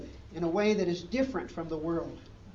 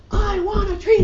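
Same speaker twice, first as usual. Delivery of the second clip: put-on very high, very loud screaming child; tantrum scream; 15 dB louder than usual. Furious girl screaming at her teacher while stomping, very high and very loud.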